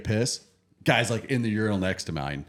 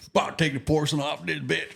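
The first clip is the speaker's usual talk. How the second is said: put-on southern accent; 6 semitones higher than usual. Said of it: "Super thick accent".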